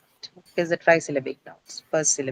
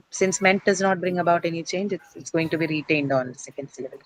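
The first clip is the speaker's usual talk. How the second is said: ordinary delivery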